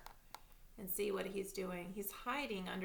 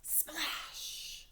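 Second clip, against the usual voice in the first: whispered splash; 6 semitones higher than usual. A narrator's reading. Sound word in story.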